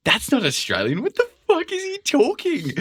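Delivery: high-pitched baffled viewer; mock disbelief, shooting up high in pitch; incredulous outburst. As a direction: act out an outraged double take. Acted baffled viewer reaction.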